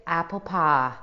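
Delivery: American Southern drawl on the vowels; Southern accent. Shown as a demonstration. Showing the hosts a trigger word, Southern accent. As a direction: open up "pie" to "pah" in a Southern accent. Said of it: "for Southern, I always say".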